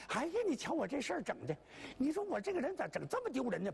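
high, fast self-scolding; fretting shoemaker. Acted. Little shoemaker scolding himself, high and fast. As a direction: fuss at yourself in a hurry.

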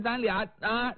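usual speaking voice

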